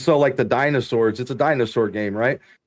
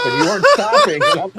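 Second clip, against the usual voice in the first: squeaky high laugh through the words; can barely get it out for laughing; telling a friend a funny story, cracking up; 4 dB louder than usual. Real, not acted. Retelling boss laughing at story.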